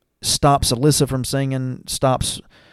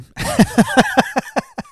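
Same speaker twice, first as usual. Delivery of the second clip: high wheezy burst of laughter; long high giggling laugh; real laughter. Real, not acted. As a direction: crack up helplessly at your own story. Laughing at being smacked on forehead.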